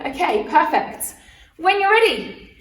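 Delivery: normal talking voice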